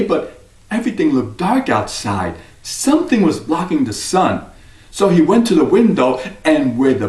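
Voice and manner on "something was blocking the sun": ordinary delivery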